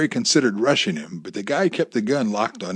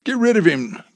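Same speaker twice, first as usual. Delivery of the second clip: high-pitched, insistent command; urgent order; 8 semitones higher, 6 dB louder, slower than usual. A narrator's reading. Gunman ordering hostage to the door, urgent command. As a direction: order it with mounting force on each now. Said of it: "he ordered Amy".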